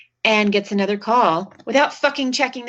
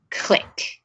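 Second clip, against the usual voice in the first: a breathy click sound; whispered phone click. Retold or imitated. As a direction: mouth the click of a hung-up phone.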